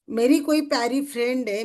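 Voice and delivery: normal talking voice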